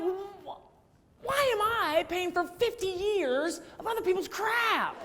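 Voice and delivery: high, indignant protest; exasperated complaint. Retold or imitated. Exasperated complaint about paying.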